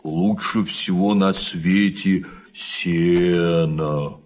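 cow voice. Acted. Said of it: "корова Мурёнка".

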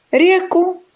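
short high rooster note; crowed syllable. A narrator's reading. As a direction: crow one syllable to be copied. Rooster crows last syllables.

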